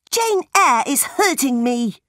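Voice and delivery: high tattling cry; shouted complaint. A narrator's reading. John telling Mamma on Jane, crying out. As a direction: call out an accusation to mother. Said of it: "John shouted".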